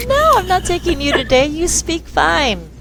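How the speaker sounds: playful refusal. Retold or imitated. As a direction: tease the kids gently. Teasing refusal to kids.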